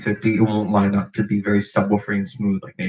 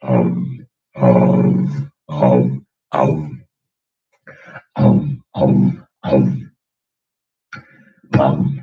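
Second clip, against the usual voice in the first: low punchy pum beats; solid chest bass; 9 semitones lower, much slower than usual. Shown as a demonstration. Demoing solid chest bass, low beats. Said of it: "solid chest bass".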